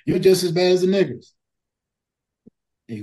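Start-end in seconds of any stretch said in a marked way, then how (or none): 0.0-1.3 raised, higher-pitched quote of an angry shout; loud mock yell; retold yell. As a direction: imitate someone yelling an insult, louder and higher. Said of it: "he yells to him".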